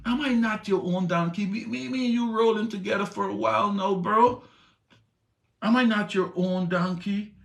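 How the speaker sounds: a loyal donkey begging its rider to notice; pleading talking donkey. Acted. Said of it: "So the donkey said to Balaam".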